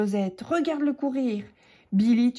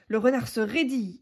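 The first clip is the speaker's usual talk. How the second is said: usual speaking voice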